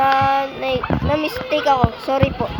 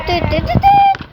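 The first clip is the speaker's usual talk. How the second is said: high squeaky beeps; 13 semitones higher, 4 dB louder, slower than usual. Pretend. Imitates beeping, last one squeaked.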